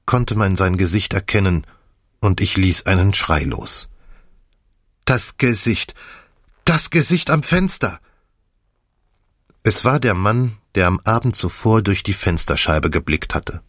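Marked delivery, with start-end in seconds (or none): 5.1-8.0 horrified outcry. Acted. Narrator recognises the face, horrified.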